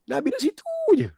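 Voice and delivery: very high, rushed cry of wonder; awed realisation. Retold or imitated. Preacher reliving the Prophet being right there, high awestruck cry. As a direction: let the voice leap up in awe. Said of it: "saya dapat rasai".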